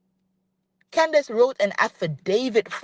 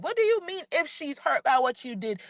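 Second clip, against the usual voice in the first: ordinary delivery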